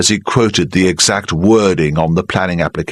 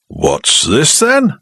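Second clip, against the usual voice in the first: gruff local man, rising on then; a big man challenging at the door; 8 semitones higher, much slower than usual. Acted. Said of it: "said the big man".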